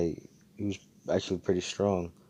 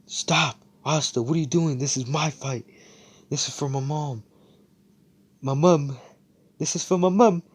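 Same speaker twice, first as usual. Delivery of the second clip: higher and louder, rising to a cry on mom; building desperate yell; 7 semitones higher, 5 dB louder than usual. Retold or imitated. Luck refusing Asta's help for his mother, building desperate yell. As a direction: insist harder with every line. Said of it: "Luck's like".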